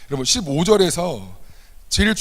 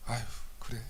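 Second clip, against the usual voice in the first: gentle; 5 semitones lower, 16 dB quieter than usual. Retold or imitated. Gentle forgiving Jesus voice.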